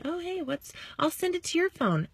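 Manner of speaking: breezy high mock offer. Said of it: "You could say".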